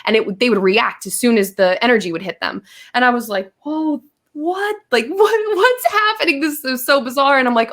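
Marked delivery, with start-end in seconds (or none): 3.7-7.4 high, astonished squeal of disbelief; shocked. Retold or imitated. She relives the shock of seeing energy tubes. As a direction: gasp it out like you can't believe your eyes. Retelling her shocked reaction to visions.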